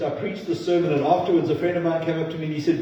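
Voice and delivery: ordinary talk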